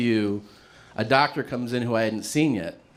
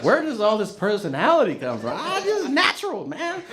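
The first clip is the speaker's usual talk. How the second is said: high-pitched, exaggerated party voice; hammed-up drunk banter; 10 semitones higher than usual. Drunk guy bantering with amazed bar friends, hammed-up and high. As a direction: push the voice way up, playing it boozy.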